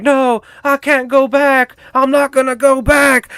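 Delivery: high put-on little-girl voice; pleading girl. Girl refusing to return to asylum, high mock pleading. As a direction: plead in a high, hammed-up child's voice.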